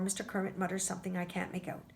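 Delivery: ordinary delivery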